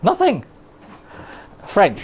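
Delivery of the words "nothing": high innocent squeak; innocent high denial. Pretend.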